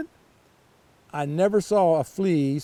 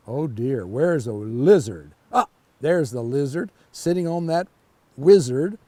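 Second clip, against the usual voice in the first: usual speaking voice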